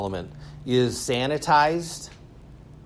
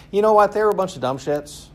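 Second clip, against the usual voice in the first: mock-blunt put-down, starting high and loud; higher-pitched mocking verdict; dismissive modernist voice; 8 semitones higher, 3 dB louder, faster than usual. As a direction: write them off as idiots. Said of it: "basically it's sort of like this".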